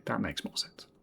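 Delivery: breathy whispered aside to himself. Real, not acted. Streamer whispering a wry aside to his chat.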